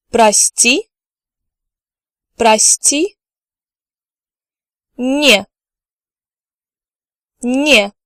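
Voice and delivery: "Извини" said clearly for repetition; teacher's careful "Извини"; clear drill. Shown as a demonstration. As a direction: model it for learners. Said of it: "I'm going to repeat each word twice".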